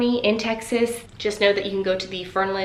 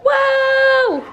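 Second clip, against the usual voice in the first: shouted, much higher whoa; startled whoa; 8 dB louder than usual. Real, not acted. Owner startled by her jumping dog, high shout. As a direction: yelp as the dog jumps. Dogs jump up.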